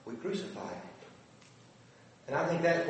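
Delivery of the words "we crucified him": softer, horrified admission; hushed horror. Retold or imitated. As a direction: let the guilt sink in quietly.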